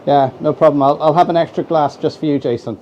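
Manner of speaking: ordinary delivery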